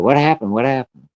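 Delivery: alarmed mother. Retold or imitated. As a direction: cry out as mom rushing over. Mom runs out alarmed.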